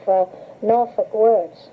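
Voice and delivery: ordinary talk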